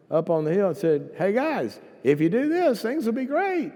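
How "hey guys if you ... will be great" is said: high, loud, hammed-up enthusiasm; rising mock-cheery announcement; chirpy salesman pitch. Moses calling to the people from the hill, hammed-up cheery.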